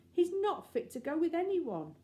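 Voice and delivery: high, loud, urgent warning; intense earnest plea. Acted. Anthea urgently warning the lady, high and loud. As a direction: beg her to believe you.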